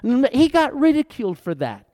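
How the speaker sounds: ordinary talk, nothing marked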